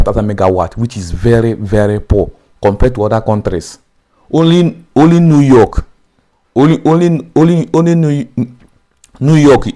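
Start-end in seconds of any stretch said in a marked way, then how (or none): 4.3-9.7 repeated only, pitched up high and loud; insistent emphasis. Real, not acted. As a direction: hammer home only New York. Insists only New York matches.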